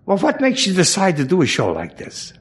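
a hint of the fawning directress; directress asking. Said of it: "She said".

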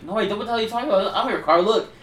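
high, protesting mock innocence; indignant denial. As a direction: act out a kid flustered by the accusation. Retold denial in a quarrel.

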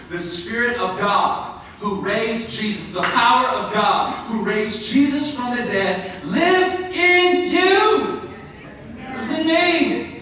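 triumphant pulpit proclamation; forceful preaching. Real, not acted. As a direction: build to a loud, high, drawn-out climax. Preaches a verse to a climax.

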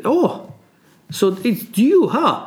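high-pitched mocking amazement; mocking surprise. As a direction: act out a king scoffing in surprise. Shapur mocking his short captive.

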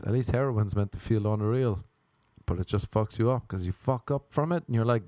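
ordinary delivery

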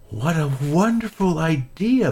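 high, bright-eyed delight. Acted. Molasses answers Peter's camping plan, thrilled. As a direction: gush over the idea.

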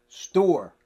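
loud, high one-word retort; exasperated outburst on a single word. Acted. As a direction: fire back STORE as the whole answer. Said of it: "you might be a little bit exasperated".

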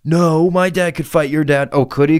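put-on high, loud kid comeback. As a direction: fire back like a defensive kid. Kids arguing about dads.

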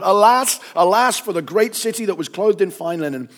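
high, loud lament without tears; mourning aloud. A narrator's reading. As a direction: cry out the alas in dramatic grief. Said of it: "weeping and mourning aloud".

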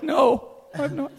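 high, slow little voice; imitated wife's voice. Wife denying she heard anything, imitated high slow voice. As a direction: pitch it way up and draw it out. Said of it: "Like, the voice".

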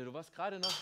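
God's voice dropped very soft, almost off mic; hushed. Acted. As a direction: speak it softly, close and tender. God speaks gently to the listener.

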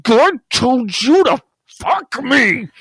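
slow, put-on lioness demand. Lioness telling lion what God said, slow and put-on. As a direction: stretch it out in a silly voice. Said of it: "she's like".

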